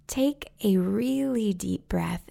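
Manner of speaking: unhurried, even breathing guidance, counts spaced out; guided meditation. Acted.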